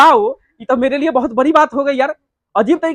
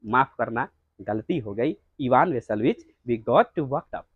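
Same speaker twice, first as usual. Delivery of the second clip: much lower and softer; meek apology; 11 semitones lower, 10 dB quieter, much slower than usual. Acted. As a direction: hush it down to a sheepish apology. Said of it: "Natalia कहती है".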